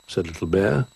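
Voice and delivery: plain reading voice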